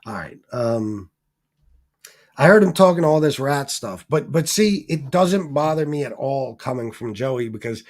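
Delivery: ordinary talk, nothing marked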